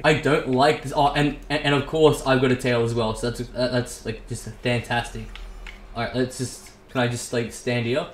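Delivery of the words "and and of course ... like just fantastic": ordinary talk, nothing marked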